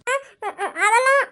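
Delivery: sobbing with a laugh breaking through; crying happy tears. Acted. Young wife laughing through tears of joy. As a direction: cry and half-laugh at once. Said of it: "எதுக்கு அழுறீங்க?".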